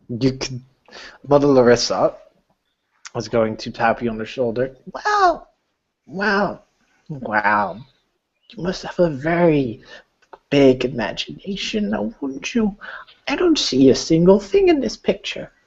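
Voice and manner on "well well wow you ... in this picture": put-on high, amused condescending old lady; high mocking granny voice; patronizing old woman. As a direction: humor the child's fantasy. Mother Larissa mocking.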